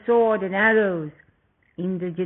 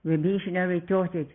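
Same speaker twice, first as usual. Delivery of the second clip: usual speaking voice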